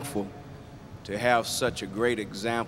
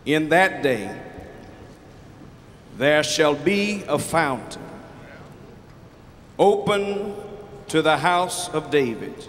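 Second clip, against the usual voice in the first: solemn scripture reading; 4 semitones higher than usual. Acted. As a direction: intone each phrase with long pauses. Reads the scripture verse.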